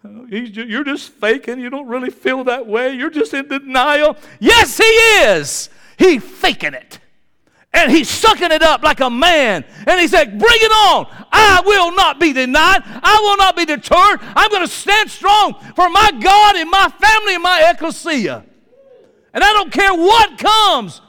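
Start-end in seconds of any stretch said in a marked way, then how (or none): 0.1-4.2 doubting critics. Acted. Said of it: "I hear people say". 4.4-9.6 very high, loud emphatic retort; full-force preaching. Real, not acted. Preacher answering the doubters, loud emphatic preaching. 10.4-21.0 high, full-volume battle cry; defiant shout. Acted. Strong man vowing to stand for his God, defiant shouting.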